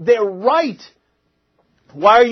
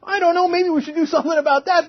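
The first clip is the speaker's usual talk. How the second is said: much higher, mock timid hedging; timid report voice; 11 semitones higher than usual. Host mocking the cautious reports, high timid hedge. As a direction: hedge it like a nervous bureaucrat. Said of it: "They took great pains to be like".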